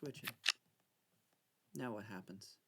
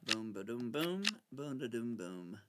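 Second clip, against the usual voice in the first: sound effects; 2 semitones lower than usual. Real, not acted. Vocal sound effects for moves.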